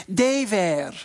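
put-on "Day wear" said as "Tay vear" in a Russian accent; mock Russian announcer, "Day wear" with a hard t and v; light Russian accent. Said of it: "of course, with a Russian accent".

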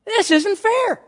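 high-pitched mock protest; indignant complaint.

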